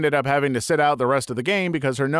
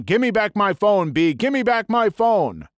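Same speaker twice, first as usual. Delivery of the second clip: raised, higher read-aloud yell; a narrator's restrained yell, pitched up; mild yell; 7 semitones higher, 3 dB louder than usual. Retold or imitated. Man demanding his phone back mid-fight, mild yell. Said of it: "he is yelling".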